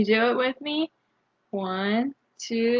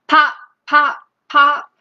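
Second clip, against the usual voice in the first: loud, higher, punchy pops; popping cork; 4 semitones higher, 8 dB louder than usual. Shown as a demonstration. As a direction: pop each one like a cork. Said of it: "He can sound like a cork".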